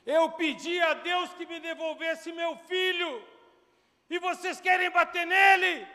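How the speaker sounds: high, very loud, distraught outcry; nervous, tearful shouting. Retold or imitated. Mother confronting those beating her returned son, agitated high shout. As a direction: cry out at the top of your voice. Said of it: "com a voz nervosa e chorando".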